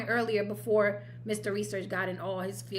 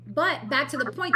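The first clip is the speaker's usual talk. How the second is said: usual speaking voice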